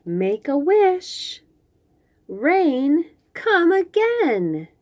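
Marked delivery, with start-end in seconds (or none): none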